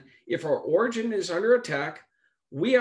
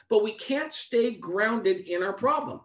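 higher, louder preaching push; 5 semitones higher, 3 dB louder than usual. Acted. Preacher urges the congregation, rising preaching. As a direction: drive the point home to the church.

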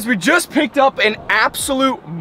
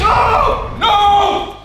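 high, loud panicked no; alarmed scream; 12 semitones higher, 5 dB louder than usual. Real, not acted. Watching the eel get chased, high alarmed scream. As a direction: scream as the fish attacks.

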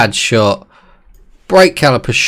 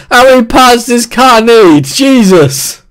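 mock voice; 10 semitones higher, 5 dB louder than usual. Mock voice complaining.

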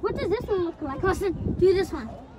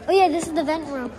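ordinary talk

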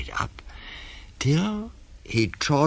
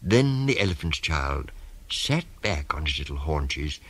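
usual speaking voice

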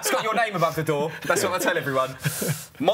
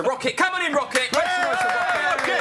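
hype introduction; 12 semitones higher than usual. Real, not acted. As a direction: bring him on like a ring announcer. Introducing guest to the crowd.

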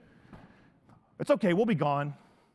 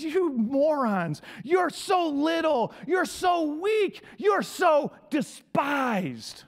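slow, drawn-out shouted rebuke; God scolding; 4 semitones higher, much slower than usual. Retold or imitated. God rebuking proud Israel, slow booming shout. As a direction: thunder it down at them slowly. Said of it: "And God says".

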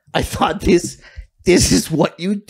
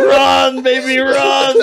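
mock shout; 6 semitones higher, 3 dB louder, slower than usual. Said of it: "What's he saying? Like".

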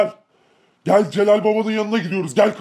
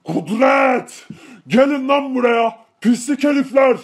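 angry shout; 4 semitones higher, slower than usual. Acted. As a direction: yell at the fleeing attackers. Attack on the gang, calling enemies. (öfkeli bağırış)

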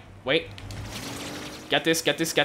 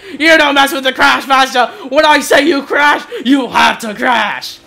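mock villain boast; 6 semitones higher, 6 dB louder than usual.

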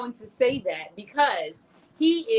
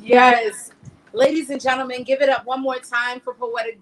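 loud, raised show-host call; rousing host; 5 semitones higher, 6 dB louder than usual. Real, not acted. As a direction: whip up applause for the poet. Host calls for applause again.

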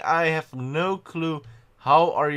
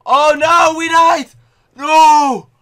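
loud, high cry of dismay; frustrated shout; 12 semitones higher, 13 dB louder, much slower than usual. Real, not acted. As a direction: shout out at dying again. Gamer dies in lava.

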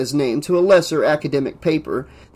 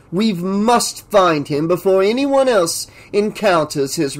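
pompous scientist, urgent; 2 semitones higher than usual. Acted. Professor orders his assistant to prepare, imperious and urgent.

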